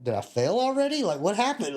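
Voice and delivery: high-pitched, baffled questioning; acting out bewildered protest; retold alarmed disbelief. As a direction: let the voice leap up in confusion. Baffled at being told he's done.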